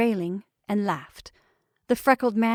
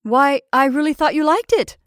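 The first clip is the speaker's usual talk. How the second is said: loud, higher mocking retort; hard-voiced jeer; 5 semitones higher, 7 dB louder than usual. A narrator's reading. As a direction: needle them, voice raised. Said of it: "He laughed in a hard voice".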